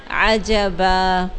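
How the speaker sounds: عجبا slow, ending in a long aa instead of an; pause form. Shown as a demonstration. Teacher shows pausing on عجبن as عجبا, slowly. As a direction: stop on عجبا with a drawn-out aa. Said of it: "تو اس کو ہم کیسے پڑھیں گے؟".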